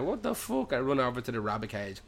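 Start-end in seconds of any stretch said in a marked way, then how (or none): 0.0-0.7 high-pitched mock bafflement; shocked outburst. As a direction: squeal it in acted disbelief. Retold shock amid the screaming.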